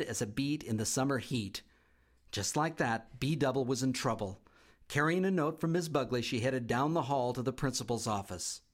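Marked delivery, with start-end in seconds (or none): none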